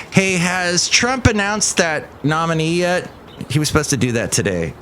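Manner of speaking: ordinary delivery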